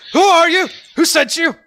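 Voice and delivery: high, very loud demand; alarmed ranger yelling; shouted interrogation. Acted. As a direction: shout questions at an intruder with bow drawn. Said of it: "he goes like".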